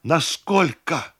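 high, drawn-out question; crying out. A narrator's reading. Cousin asks how much tax, crying out. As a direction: cry the question out in alarm. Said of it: "крикнула кузина".

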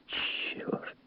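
hushed, voiceless curse; a low-volume whispered oath; whispered exclamation. A narrator's reading. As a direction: swear under your breath. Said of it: "воскликнул Монтег".

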